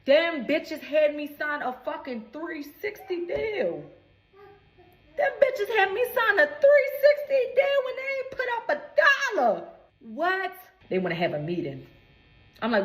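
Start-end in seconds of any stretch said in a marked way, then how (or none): 5.1-10.5 outraged shout. Real, not acted. Outraged retelling of the deal.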